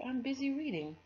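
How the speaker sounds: put-on high, brushing-off kid voice; dismissive girl voice. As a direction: wave him off, busy.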